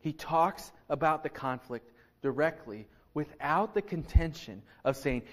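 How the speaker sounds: usual speaking voice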